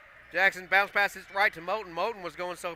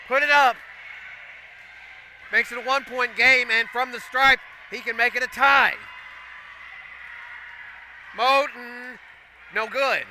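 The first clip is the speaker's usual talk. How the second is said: higher and louder, racing through the play; excited call; 7 semitones higher, 3 dB louder than usual. Real, not acted. Announcer calling the steal that ties it up, excited call. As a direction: keep the energy up as the lead shrinks. Steal and score called live.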